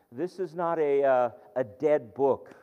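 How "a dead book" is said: usual speaking voice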